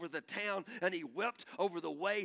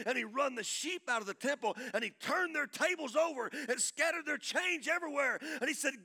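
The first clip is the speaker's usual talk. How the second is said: high, driving storytelling from the pulpit; fervent preaching; 9 semitones higher, 7 dB louder than usual. Real, not acted. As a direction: preach the action at full tilt. Preaching at full force.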